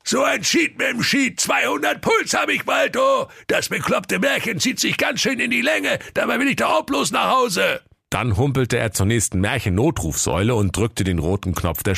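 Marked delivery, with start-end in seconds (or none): 0.0-7.8 very high ogre voice, half sung and shouted; grumbling ogre. Acted. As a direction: moan about the never-ending fairy tale. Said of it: "Und der hungrige Menschenfresser Karl-Heinz sprach".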